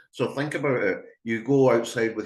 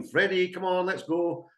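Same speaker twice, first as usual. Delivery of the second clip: impatient call; 9 semitones higher than usual. Retold or imitated. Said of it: "adding on the name".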